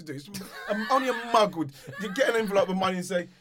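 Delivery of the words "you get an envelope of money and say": ordinary talk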